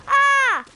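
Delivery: high, loud open scream; panicked scream. Real, not acted. Gamer attacked by a bad guy, high scream. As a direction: scream as the enemy gets you.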